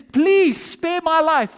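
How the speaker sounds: high, loud, drawn-out begging; desperate plea. Acted. Condemned man grabs the queen and begs, desperately. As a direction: beg for your life, stretching the please. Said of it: "He grabs Esther and he says".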